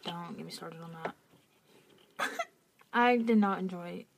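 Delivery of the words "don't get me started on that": whispered aside; whispering. Real, not acted. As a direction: breathe it out as a secret aside. Aside about a disliked soundtrack.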